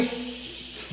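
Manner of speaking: soft hushing sound; shush. A narrator's reading. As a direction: bring everything to hush. Angels leave, story quiets.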